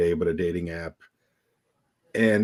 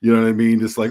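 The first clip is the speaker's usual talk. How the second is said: ordinary talk, nothing marked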